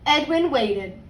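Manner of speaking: put-on lively read-aloud voice for kids; story narration. A narrator's reading. Teacher reading a picture book to her class, storytime voice.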